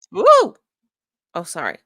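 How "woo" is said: loud, high whoop; sudden yell. Real, not acted. Streamer startles the viewers with a woo, yelled aggressively loud. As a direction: let out a big high "woo" at full volume. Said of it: "I just yelled at you guys".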